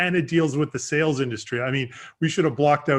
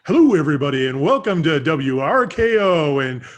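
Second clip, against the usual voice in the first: mock radio announcer intro; radio host voice; 4 semitones higher, 6 dB louder than usual. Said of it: "now we need to hear your radio voice".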